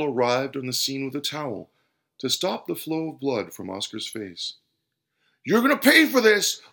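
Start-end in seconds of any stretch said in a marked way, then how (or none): 5.4-6.6 high, loud furious threat; enraged, punching the words; spitting anger. A narrator's reading. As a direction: shout the threat at full force. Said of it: "spat the customer".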